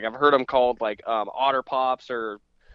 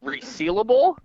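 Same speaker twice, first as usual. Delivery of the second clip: high, incredulous question; disbelieving echo, pitch up high; surprised; 10 semitones higher, 4 dB louder than usual. Real, not acted. Friend reacts to snack label.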